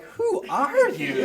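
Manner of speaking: baffled disbelief. Retold or imitated. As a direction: stretch it out as a bewildered cop. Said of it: "looking at me like".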